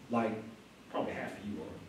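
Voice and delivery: soft, low aside; under his breath. Retold or imitated. Paul to the Pharisees in the council, a quiet aside under his breath. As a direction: let it slip out quietly, barely voiced. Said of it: "He may have said that under his breath".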